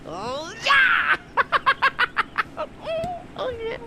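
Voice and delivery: high, shouted yeah into a wild laugh; ecstatic cheer. Acted. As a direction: whoop with triumph and burst out laughing. Heist succeeds, celebrating.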